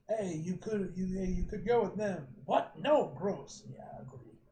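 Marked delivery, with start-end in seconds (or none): none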